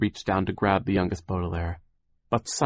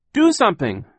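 much higher, loud call; desperate cry; 13 semitones higher, 9 dB louder than usual. Acted. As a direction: call out to her brother in panic. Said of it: "Violet cried".

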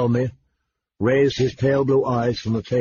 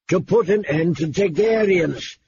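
growled; 6 semitones higher than usual. A narrator's reading. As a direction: growl it like a resentful king. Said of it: "the king growled".

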